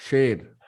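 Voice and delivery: "शेर" with a clear sh; tongue back to open "शेर"; SH demo. Shown as a demonstration. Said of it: "correct is the placement of tongue". ("शेर" में साफ़ श)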